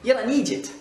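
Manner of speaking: high, loud Scottish insult; Scottish accent. Shown as a demonstration. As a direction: turn "idiot" into Scottish "eejit". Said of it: "quand je le dis". (accent écossais, voix haute)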